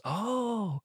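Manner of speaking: a high, soft mock "Oh"; mock surprise. Teacher teasing a student counting on his fingers, mock surprise. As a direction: fake being impressed.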